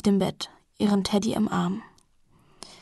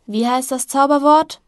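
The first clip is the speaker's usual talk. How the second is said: higher and louder call across the house; calling back; 5 semitones higher, 7 dB louder than usual. A narrator's reading. Milia calls back to little Jessie, raised call. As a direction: call out to the other room. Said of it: "rufe ich zurück".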